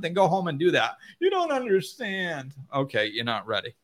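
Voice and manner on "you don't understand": high-pitched put-on complaint; hammed-up plaintive objection; mock whiny protest. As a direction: imitate a misunderstood whiner. Acts out whiner protesting.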